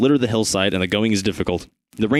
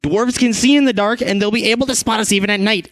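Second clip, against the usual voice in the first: higher scolding tone; angry at dog; 10 semitones higher than usual. Acted. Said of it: "you say angrily".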